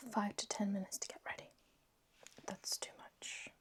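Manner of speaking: soft early-morning whisper. Real, not acted. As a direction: whisper so the flatmate sleeps on. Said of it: "whisper a little bit".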